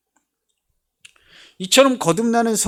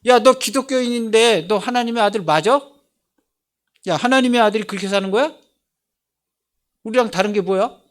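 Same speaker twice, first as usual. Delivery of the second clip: louder, higher jeering questions; taunting challenge; 5 semitones higher, 5 dB louder than usual. Retold or imitated. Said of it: "수많은 사람들이 나한테 와서 물어봐요".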